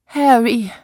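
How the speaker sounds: high, loud stagey wail of the name; over-the-top stage lament; theatrical cry. Acted. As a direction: ham it up like a melodrama heroine. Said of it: "rief Selina theatralisch".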